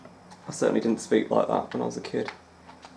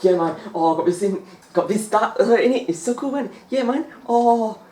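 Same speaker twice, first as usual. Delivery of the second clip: much higher, excited slangy dude; slangy sketch dudes; 11 semitones higher than usual. Retold or imitated. Describing a comedy sketch, imitating the slang-talking dudes in a much higher voice. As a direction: hype it up like a cool guy showing off. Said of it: "they just use slang words so much".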